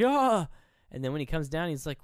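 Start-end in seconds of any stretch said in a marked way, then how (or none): 0.0-0.5 short mock scream, high and loud; sudden high "ah" of horror; retold scream. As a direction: imitate a startled yelp. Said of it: "screams, and is like".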